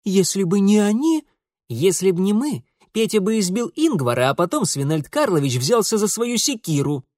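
put-on high, loud girl's voice explaining; reasoning daughter. A narrator's reading. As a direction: argue the case point by point. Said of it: "рассудительно начала Зина".

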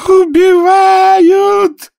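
very high, loud, long-drawn scream; scream for help. Acted. Arthur, seized by the sailor, screams long and high. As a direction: scream it out at full stretch. Arthur being throttled cries out.

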